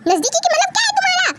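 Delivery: fast, high-pitched retort; indignant challenge. Acted. Visitor challenges staff on closest-relative rule, indignant. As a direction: fire it back, offended.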